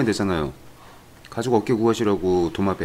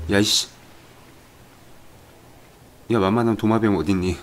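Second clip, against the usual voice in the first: ordinary talk, nothing marked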